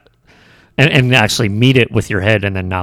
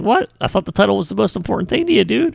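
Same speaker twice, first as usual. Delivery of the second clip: surprised; 10 semitones higher, faster than usual. Retold or imitated. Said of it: "I was like".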